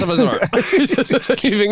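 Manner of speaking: high, slow, broken by laughter; giggling; laughing. Real, not acted. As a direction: squeeze the words through a laugh. Laughing at joke.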